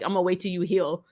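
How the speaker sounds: ordinary talk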